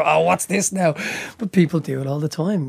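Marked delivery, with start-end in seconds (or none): 0.0-0.9 mock objection. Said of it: "They go".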